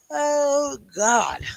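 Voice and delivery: words through a yawn; mid-yawn stretched groan. Real, not acted. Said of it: "I keep yawning".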